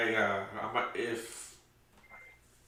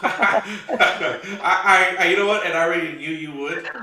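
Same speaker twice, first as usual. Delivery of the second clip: laughing loudly through the words; laughter bubbling up as he talks; 8 semitones higher, 15 dB louder, slower than usual. Real, not acted. Laughing at Michelle's teasing.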